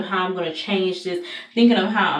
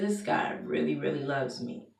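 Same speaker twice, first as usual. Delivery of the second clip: softer, fading to near hush; hushed realization, much softer; quiet awe; 5 semitones lower, 11 dB quieter than usual. Retold or imitated. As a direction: say it almost to yourself in wonder. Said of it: "I said".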